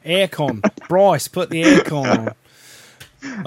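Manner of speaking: slow, put-on Aussie accent; playful mock-Australian drawl. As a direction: imitate an Australian calling to a mate. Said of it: "when you have an Australian accent".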